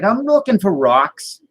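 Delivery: high, slow mock Boston voice; Boston accent. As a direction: stretch it out in a put-on Boston accent. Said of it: "why do you have a Boston accent".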